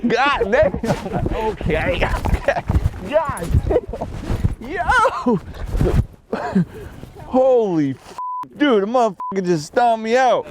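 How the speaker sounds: high, laughing amazed exclamations; hyped disbelief. Real, not acted. Reacting to the crash with friends, high laughing disbelief. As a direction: react like you can't believe it. Laughing after a hard fall.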